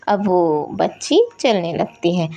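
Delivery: plain reading voice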